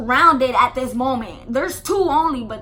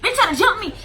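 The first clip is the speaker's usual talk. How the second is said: higher, louder retelling; excited; 8 semitones higher, 7 dB louder, faster than usual. Retold or imitated. Telling Aisha about the attack, excited and louder. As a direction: blurt it out, half laughing.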